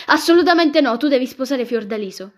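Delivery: loud, high refusal at the outset; disapproving. A narrator's reading. Aunt forbids her nephew's choice of bride, disapproving. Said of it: "con aria di disapprovazione".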